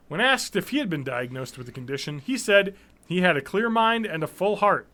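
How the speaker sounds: normal talking voice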